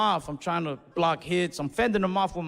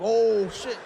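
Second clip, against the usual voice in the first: surprised; 4 semitones higher, 4 dB louder than usual. Retold or imitated. Shocked by his own punch.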